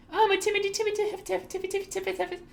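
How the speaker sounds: dużo wyżej, piskliwe, drwiące powtarzanie; przedrzeźniaj bojaźliwego chłopaka falsetem; mocking timid voice. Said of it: "you're gonna do the little timidity".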